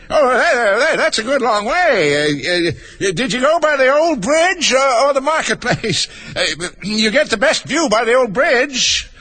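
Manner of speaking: high, hesitant, quavering king; squeaky, dithering storybook king; nervous quivering. A narrator's reading. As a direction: stammer anxiously to smooth things over. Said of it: "his voice quite quivered".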